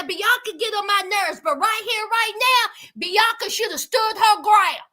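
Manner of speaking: usual speaking voice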